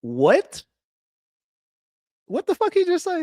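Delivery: streamer can't believe what the storyteller just said, stunned; stunned disbelief. Real, not acted. Streamer stunned by clip's claim.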